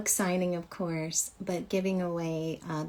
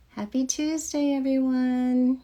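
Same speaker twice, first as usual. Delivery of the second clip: high, bright and louder, calling out to viewers; excited greeting; 5 semitones higher, 5 dB louder than usual. Real, not acted. Host greeting her livestream viewers, excited and loud. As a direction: sing out the welcome loud and high.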